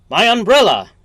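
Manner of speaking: loud, high cry of alarm; shouted alarm. Acted. Shop customer shouting after Soapy over his umbrella, loud and high. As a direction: call out after the thief.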